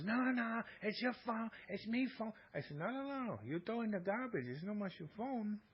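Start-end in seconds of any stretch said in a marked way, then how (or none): 0.0-2.3 higher, insistent protest in the wife's voice; imitating wife. Wife insisting to husband the phone is still hers, higher and insistent. 2.8-5.6 higher, mocking no no no; teasing refusal. Husband teasing wife that the thrown phone is now his, higher mock refusal.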